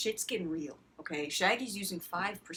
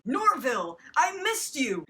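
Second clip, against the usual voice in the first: mock joyful reunion, much higher; joyful cry; 6 semitones higher, 5 dB louder than usual. Reunited lover crying out to old friend, delighted and higher. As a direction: call his name in delight.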